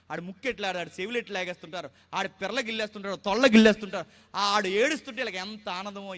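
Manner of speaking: ordinary talk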